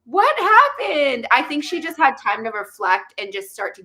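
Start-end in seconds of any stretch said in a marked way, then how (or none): 0.1-1.3 high, slow, drawn-out gasp of a question; mock shocked. Co-host feigns shock at the twist, drawn-out and high. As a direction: stretch it out like a scandalised gossip.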